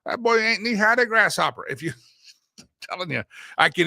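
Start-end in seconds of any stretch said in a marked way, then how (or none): 0.1-1.7 put-on higher, louder old-timer voice; a country elder teasing a small boy; folksy saying, hammed up. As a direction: sound like a grandparent in the South. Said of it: "how many of you".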